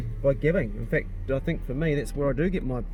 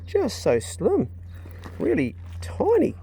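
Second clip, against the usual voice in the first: cooing over the dog; 11 semitones higher, slower than usual. Real, not acted.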